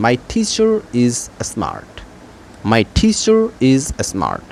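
teacher is with the r linked, slowly; linking r. Shown as a demonstration. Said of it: "my teacher বলতে হবে". (my teacher is smart, আর জুড়ে ধীরে)

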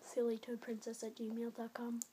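very soft whispered email address; sneaky whisper. Real, not acted. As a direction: whisper it into the camera. Slips in own email address.

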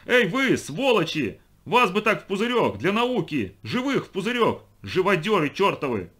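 high, loud yell at a gang; angry shouting. A narrator's reading. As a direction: hurl furious insults from a distance. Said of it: "закричал". (яростно кричать высоким голосом)

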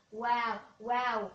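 normal talking voice

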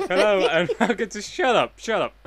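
laughing. Acted. Mock intro while laughing.

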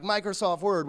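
normal talking voice

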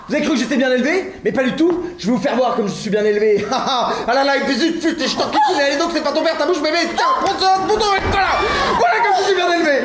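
high-pitched comic tirade, mounting; ranting. Acted. Man shows lover he is ill-bred, mounting rant.

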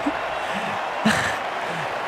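breathy chuckle under the breath; quiet laugh. Real, not acted. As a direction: let out a soft laugh. Laughing at the game score.

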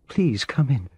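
high, tearful woman's voice; shaky voice. A narrator's reading. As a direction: voice trembling on the verge of tears. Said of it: "she said in a shaky voice".

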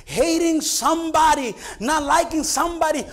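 high, loud, emphatic sermon cry; full-force preaching. Real, not acted. Pastor warning that hatred is embryonic murder, full-force preaching. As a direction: drive the warning home to the congregation.

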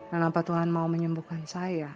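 usual speaking voice

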